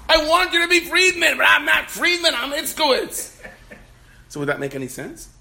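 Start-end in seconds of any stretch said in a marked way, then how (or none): 0.1-3.4 high, loud shouted argument; hammed-up shrieking back and forth; mock screaming. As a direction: yell both sides of an absurd argument. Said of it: "Why are you screaming at him?".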